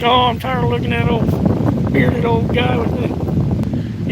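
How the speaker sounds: slow, drawn-out teasing viewer voice; a touch of a weary viewer, dragged out; mock bored viewer. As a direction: lightly play a viewer sick of his face, slowly. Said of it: "You're, you're like".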